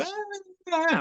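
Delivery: very high, soft mimicked "ah"; mock excited squeal. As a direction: imitate a gushing squeal. Imitates people running up to him.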